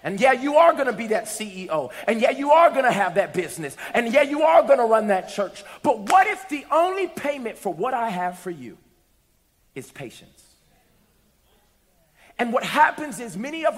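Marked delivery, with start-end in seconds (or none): 0.0-10.4 loud, high, fiery preaching. Acted. Pastor preaching patience to the congregation, fired up and loud. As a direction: lift the congregation up with full force.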